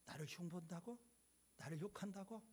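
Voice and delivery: quietly stung, asking it half to himself; hurt disbelief. A narrator's reading. Bison hearing the lion's gossip, softly hurt. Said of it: "서운한 마음이 조금 들어오기 시작을 해요".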